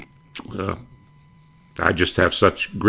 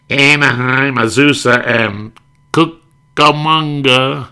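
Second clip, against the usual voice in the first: loud, higher call, drawing out the last name slowly; train announcer; 8 semitones higher, 7 dB louder, slower than usual. Retold or imitated. Conductor announcing the stops loudly, stretching the last. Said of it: "the train conductor".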